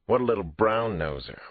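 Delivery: a kid brother mocking; lightly voiced jeering boy; teasing boy. A narrator's reading.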